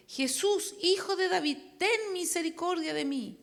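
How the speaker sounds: underplayed cry for mercy; restrained cry. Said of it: "comenzó a dar voces y a decir".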